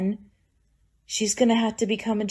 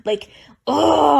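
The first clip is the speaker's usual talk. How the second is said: a loud, pitched-up burst of frustration; exasperated outburst; 4 semitones higher, 6 dB louder than usual. Real, not acted. Vlogger venting about her mother, loud and exasperated. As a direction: blurt it out, fed up.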